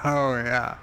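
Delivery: usual speaking voice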